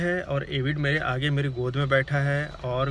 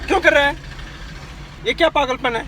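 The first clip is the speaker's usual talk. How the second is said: much higher, louder telling-off; raised-voice rebuke; angry scolding; 8 semitones higher, 3 dB louder than usual. Real, not acted. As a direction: scold the pets sharply. Scolding the barking pets.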